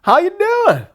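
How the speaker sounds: high, bright drawn-out greeting; friendly Southern greeting. Retold or imitated. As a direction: greet like a chatty Southern stranger. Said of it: "first guy I met said".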